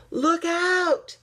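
sharp, high, loud warning shout; cried warning. Acted. Bear warning friends as the block tower tumbles, shouting. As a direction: yell out as the tower falls. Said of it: "he cried".

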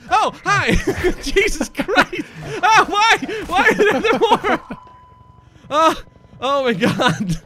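high startled yelps with laughter; shocked giggly shriek; startled laughing. Real, not acted.

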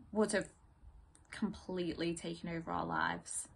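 hushed, halting, with long pauses; subdued dramatic monologue. Acted. Partner telling the other it's for the best, subdued and halting. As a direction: think aloud softly, trying to convince yourself. Performs audition monologue from Lungs.

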